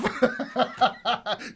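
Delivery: real burst of laughter; loud laugh. Real, not acted. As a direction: crack up at the prank. Cracks up.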